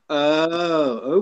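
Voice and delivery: ordinary talk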